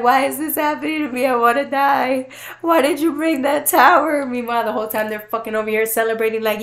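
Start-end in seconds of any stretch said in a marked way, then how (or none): 0.0-4.3 whiny mock despair. Imitating her despair.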